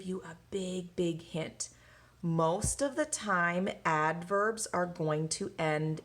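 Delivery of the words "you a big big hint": breathy secret whisper to the class. Acted. Teacher whispering a big hint to her students, hushed whisper.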